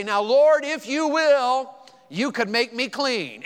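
high, loud and slow, like giving orders; brash demanding version. Shown as a demonstration. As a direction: push it like a bold demand. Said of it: "We'd say it like this".

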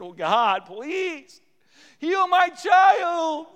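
loud plea climbing to a drawn-out shout; high, stretched, wailing prayer; anguished begging. Acted. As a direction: cry out to God as a desperate parent. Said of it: "and they begged".

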